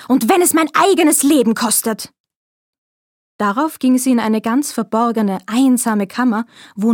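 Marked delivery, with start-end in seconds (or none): 0.1-2.1 Zorn. A narrator's reading. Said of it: "rief sie".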